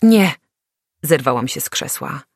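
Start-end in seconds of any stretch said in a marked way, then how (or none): 0.0-0.3 loud, high burst of no; a loud, high, sudden no; sharp refusal. A narrator's reading. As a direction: cut them off with one word. Jumps up refusing.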